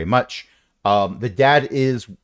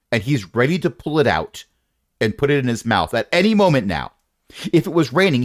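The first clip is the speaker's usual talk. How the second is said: normal talking voice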